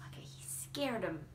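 hushed whisper; quiet aside. Real, not acted. Reader whispering relief to the children.

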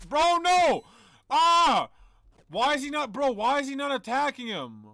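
high, loud frantic yelling; desperate, freaking out; panicking gamer. Real, not acted. Character dying, safe spot fails.

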